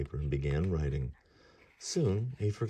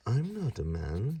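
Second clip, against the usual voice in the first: slow, higher matter-of-fact voice; young god; 7 semitones higher, slower than usual. Acted. Ganesha answering Vyasa, slow higher voice. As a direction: point it out like a cheeky boy. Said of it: "said Ganesha".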